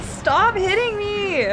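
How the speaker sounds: high, drawn-out protest; long wailing complaint with laughter; exasperated plea. Real, not acted. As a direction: stretch the plea out, pitched high. Protesting at game hits.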